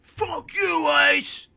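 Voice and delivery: high yelled taunt at the cops; defiant yell. Retold or imitated. Parkour guy yelling at the cops, high mock shout. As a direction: shout it at the police from the car roof. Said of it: "and it's like".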